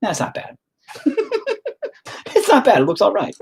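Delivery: high, laughing self-praise; laughing relief. Real, not acted. Artist laughing with relief at his painting. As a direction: giggle at how well it turned out. Artist pleasantly surprised by work.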